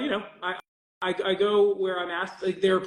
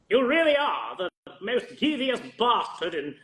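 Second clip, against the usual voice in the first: Matt Berry impression; 3 semitones higher, slower than usual. Voice actor signing an autograph as Matt Berry. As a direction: stretch it out slow like Matt Berry. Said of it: "Yes, Matt Berry".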